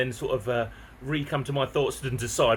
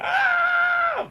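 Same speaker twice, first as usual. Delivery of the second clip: very high, loud imitated shriek; mock scream; 5 dB louder than usual. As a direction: squeal like she's panicking. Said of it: "She's like".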